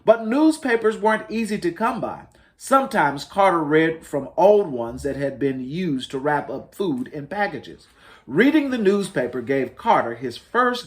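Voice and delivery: lightly lifted storybook read-aloud; reading to children. Acted. Librarian reading to families, mild storytelling voice. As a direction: tell it gently like a picture book. Reads picture book aloud.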